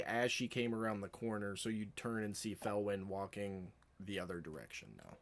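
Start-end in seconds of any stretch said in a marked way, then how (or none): none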